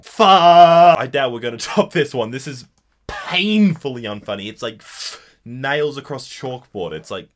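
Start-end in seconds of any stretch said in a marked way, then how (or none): 0.0-1.0 much louder, a sudden higher burst; startled loud curse. Real, not acted. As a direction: let it explode out of you. Startled reaction to the meme.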